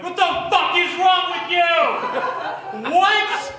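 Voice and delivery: loud, high disbelieving yell with a laugh; shocked shout. Retold or imitated. Retelling his yell at the boy, loud and shocked. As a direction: yell at the kid in horrified disbelief. Shocked reaction to pulled tooth.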